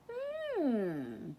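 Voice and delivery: a sly musing hmm; thoughtful hmm. A narrator's reading. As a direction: savour the plan. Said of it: "she thought".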